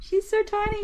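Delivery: very high, adoring coo; gushing high coo. Real, not acted. Groomer marvels at how tiny the dog is, high coo.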